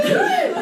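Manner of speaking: short, high, loud laugh; sudden high embarrassed laugh; high burst of laughter. Real, not acted. Embarrassed reading her diary.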